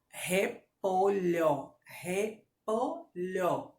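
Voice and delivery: slow "Repolho" in three separate syllables; syllable-by-syllable dictation. Shown as a demonstration. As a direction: break "Repolho" into re, po, lho. Teacher divides word into syllables.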